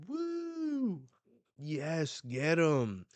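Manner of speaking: high, loud whoop and egging on; acted spectator excitement; cheering crowd. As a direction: cheer on the hunters. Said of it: "people are cheering".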